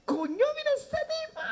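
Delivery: high, slow, drawn-out astonished cry; mock amazed exclamation. Shown as a demonstration. Comedian demos the crowd's reaction to his entrance, high and slow. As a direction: show the crowd how to gasp at him. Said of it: "ustedes dicen".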